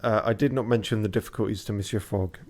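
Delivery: put-on lightly French-accented narration; a hint of French on Monsieur Fogg; French-accented reading. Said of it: "that was a fairly good French accent".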